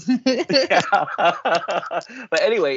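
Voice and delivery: real laughter through the words, high-pitched; laughing while trying to move on. Real, not acted. Laughing at co-host's joke.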